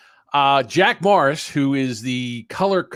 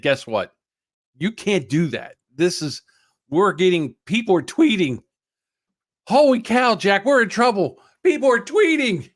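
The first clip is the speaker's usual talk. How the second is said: panicked retelling; 6 semitones higher, 4 dB louder than usual. Retold or imitated. Retold boss warning.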